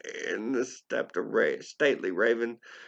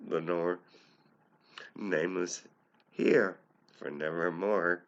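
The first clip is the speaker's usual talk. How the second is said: ordinary delivery, nothing marked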